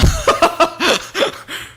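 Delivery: high, breathy burst of laughter; short incredulous laugh; exasperated laugh. Real, not acted. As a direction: laugh in disbelief. Laughing at father drinking again.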